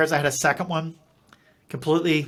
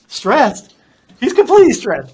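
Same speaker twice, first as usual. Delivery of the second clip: much higher, louder incredulous wife voice; imitating exasperated wife; 8 semitones higher, 10 dB louder than usual. Wife telling the doctor he is stressed, in an exasperated, much louder voice. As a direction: mimic his wife scoffing in disbelief.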